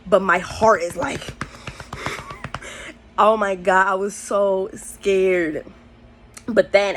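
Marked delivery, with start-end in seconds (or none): 1.7-2.9 a long breathy sigh out of panic; shaky breathless sigh. Real, not acted. Retelling her high, a panicked whispered sigh. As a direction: let the air out, scared and drained. Heart racing, panicking inside.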